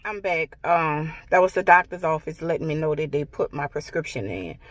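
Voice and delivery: normal talking voice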